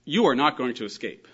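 loud, weighted YOU ARE NOT; very emphatic. Acted. Christ warning the Pharisees, very emphatic statement. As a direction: declare it firmly as a verdict. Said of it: "very emphatically".

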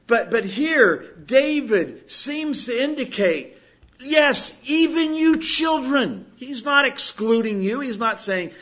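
loud, rousing preacher's exhortation; preaching at full force. Acted. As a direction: preach it out to the whole church. Preacher exhorts the congregation loudly.

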